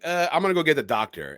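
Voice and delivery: high, fast nervous excuse; mock Thomas. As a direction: rush it out like a scared husband. Acted quote of Thomas.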